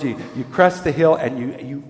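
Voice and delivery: normal talking voice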